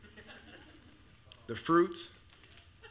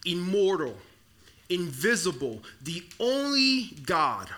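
liturgical reading; 2 semitones lower than usual. Acted. Pastor closing the reading to the congregation, solemn and hushed. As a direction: read it like a benediction.

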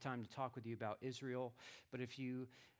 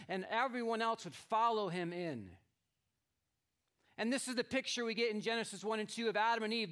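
raised, louder preaching voice; 8 semitones higher, 9 dB louder than usual. Acted. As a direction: proclaim the story to the congregation. Preaches the king illustration.

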